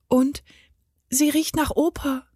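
put-on high-pitched child's voice; small girl objecting to Mama; little girl. A narrator's reading. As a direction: plead sweetly like a small daughter. Narrator voices the little daughter.

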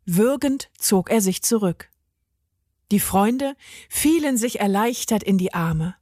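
ordinary delivery

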